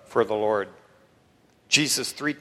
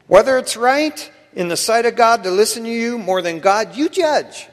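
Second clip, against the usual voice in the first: loud, raised-pitch defiant declaration; bold defiance; 8 semitones higher, 8 dB louder than usual. Acted. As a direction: stand up boldly to the council. Apostles' defiant answer to the council.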